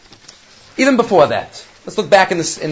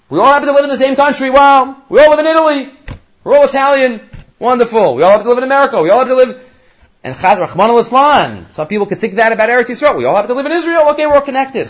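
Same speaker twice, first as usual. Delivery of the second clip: high, sarcastic singsong of a naive view; mocking high voice; 8 semitones higher, faster than usual. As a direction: lift it high and poke fun at the idea. Mocking what other nations think.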